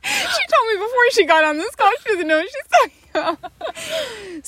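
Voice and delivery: high, loud, laughing jab; teasing shout. Real, not acted. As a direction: call her out through laughter. Teasing her sister, laughing shout.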